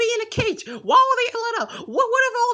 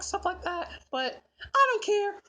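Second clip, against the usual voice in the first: usual speaking voice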